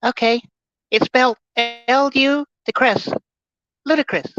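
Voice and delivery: high-pitched Mike Tyson imitation, slowing to spell it out; jokey Mike Tyson impression. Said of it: "my voice is like, like Mike Tyson right now".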